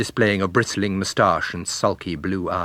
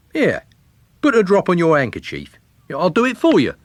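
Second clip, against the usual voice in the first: higher, louder cheeky lad; cocky young man; 9 semitones higher, 6 dB louder than usual. A narrator's reading. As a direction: push in like a forward young fellow. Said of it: "said the boy with her".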